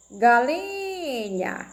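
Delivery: "Galinha" with a long nasal LIIINH; nose pinched. Shown as a demonstration. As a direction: say "Galinha" slowly while holding the nose. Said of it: "Tampa o nariz".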